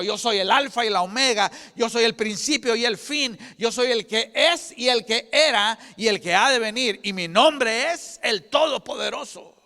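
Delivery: loud, high, ringing preacher's cry; preaching at full force. Acted. Preacher rallying the congregation, loud and high. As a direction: build the faith call up to a shout.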